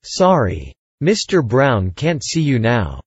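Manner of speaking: usual speaking voice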